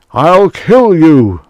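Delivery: high, very loud drawn-out threat; shouting. A narrator's reading. Fire god raising his gun at the priest, shouting. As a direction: shout it in a murderous rage. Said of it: "Mersu shouted".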